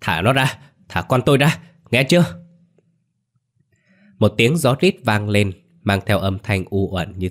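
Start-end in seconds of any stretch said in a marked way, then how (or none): 0.0-2.4 raised, demanding angry cry; shout through gritted teeth. A narrator's reading. Father fighting the river for his son, straining angry shout. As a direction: demand it through clenched teeth, pitch climbing. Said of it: "Ông nghiến chặt răng hét to".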